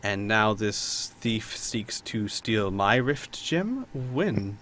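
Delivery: very slow, soft, serious noblewoman; Lady Trask. Acted. Baroness demands the timing of the theft, quiet gravity. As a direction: weigh each word quietly, then ask briefly. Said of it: "taking this a little more seriously".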